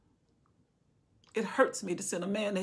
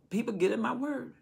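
usual speaking voice

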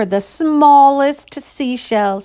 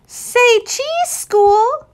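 high, sing-song photographer's call; playful girl voice; 12 semitones higher, much slower than usual. Acted. Ruthie playfully posing the clouds for a photo. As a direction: coax the clouds to smile.

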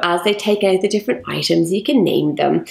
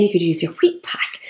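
normal talking voice

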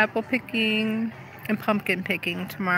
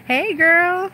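excited greeting; 11 semitones higher, 6 dB louder than usual. Real, not acted. Greeting a passing friend.

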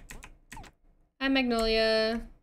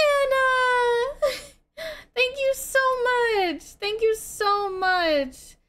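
very high, gushing thanks; overjoyed high-pitched gratitude; excited squeal; 12 semitones higher, slower than usual. Real, not acted. Squeals thanks at a viewer's gift.

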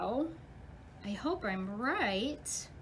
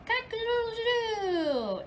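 rooster crow; 10 semitones higher than usual. Acted. Rooster in the barn.

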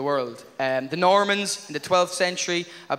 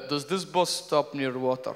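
softer, lower innocent inquiry; sly killer passenger; 5 semitones lower, 7 dB quieter than usual. Pretend. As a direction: ask it too casually. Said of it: "Him getting on the bus going".

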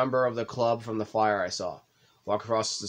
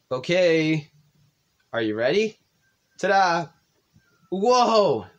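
excited; 7 semitones higher, 6 dB louder, much slower than usual. Acted. Natsuki unveiling the cupcakes.

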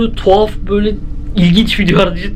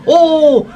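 sudden high yelp of fright; startled yelp; 7 semitones higher, 3 dB louder than usual. Real, not acted. Watching a creepy VR video, a startled high yelp. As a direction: jolt out a short high "Oo!". Thomas appears at the window.

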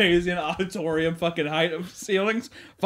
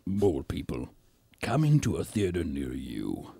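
movie trailer voice; 11 semitones lower, 6 dB quieter, slower than usual. Pretend.

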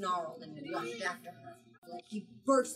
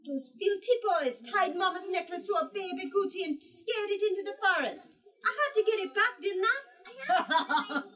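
put-on much higher, eager young girl; girl's voice; 11 semitones higher, 5 dB louder than usual. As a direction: pitch it up like an excited child. Daughter voiced, excited retelling.

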